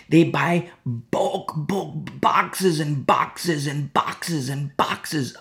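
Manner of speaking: very slow, stretched repetition; slowly listing the boxes to mock the excess; drawn out. Real, not acted. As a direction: stretch each word to show the sheer amount.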